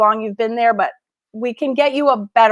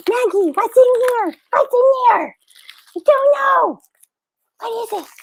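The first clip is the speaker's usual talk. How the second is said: high-pitched questions to a pet; playful pet talk; 9 semitones higher than usual. Real, not acted. Owner asking Maggie what's in the package, playful pet talk.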